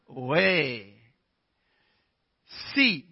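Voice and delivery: ordinary talk, nothing marked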